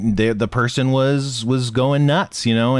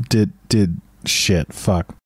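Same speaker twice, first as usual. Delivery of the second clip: ordinary delivery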